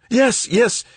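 very high, loud, eager agreement; fervent. A narrator's reading. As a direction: jump at the offer. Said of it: "he said fervently".

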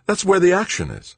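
high, loud and thrilled; excited. A narrator's reading. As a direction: punch it out eagerly. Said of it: "he told her excitedly".

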